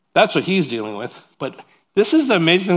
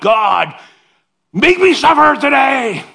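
high, loud sing-song prayer voice; mock prayer begging to suffer; mock pious prayer; 10 semitones higher, 7 dB louder, slower than usual. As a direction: ham up an eager plea for pain.

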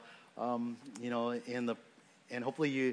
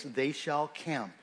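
"shall" stressed, slow and deliberate; "They shall camp" spelled out slowly; emphasis on shall; much slower than usual. Shown as a demonstration. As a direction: lean on "shall". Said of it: "the key verb here is mentioned twice".

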